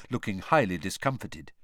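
ordinary delivery, nothing marked

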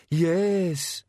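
high monster whimper; high, meek creature voice; cowed bogeyman. Acted. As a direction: answer as a pinned, cowed bogeyman. Cowed bogeyman answers Susan.

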